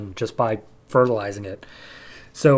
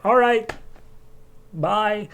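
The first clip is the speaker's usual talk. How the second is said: high-pitched, offhand goodbye; baffled send-off; 8 semitones higher, slower than usual. Retold or imitated. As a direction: toss out a puzzled bye in a high voice. Guy reacting to Jack flying off.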